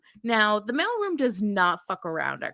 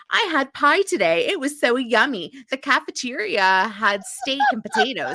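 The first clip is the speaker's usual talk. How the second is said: higher, louder sing-song daughter report; chirpy mock daughter; 4 semitones higher, 6 dB louder than usual. Felicity phoning her parents, mock chirpy and louder. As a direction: play a cheery oblivious college kid. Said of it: "I'm sure she's just like".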